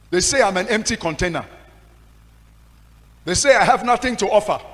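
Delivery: high, loud, desperate lament; hurt outcry to God; anguished cry to God. Retold or imitated. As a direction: plead with God in despair. Crying out to God.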